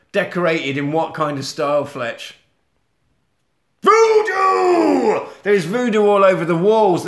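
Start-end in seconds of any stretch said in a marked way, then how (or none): 3.8-7.0 long, high, loud cry of the word Voodoo; drawn-out spooky shout; dramatic shout. Acted. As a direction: hold it out like a horror trailer. Dramatic reveal of wall style.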